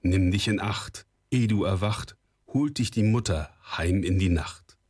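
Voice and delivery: plain reading voice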